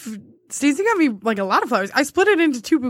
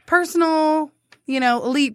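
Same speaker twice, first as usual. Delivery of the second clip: sheepish pitch; 7 semitones higher than usual. Retold or imitated. Retold own pitch, high, laughing.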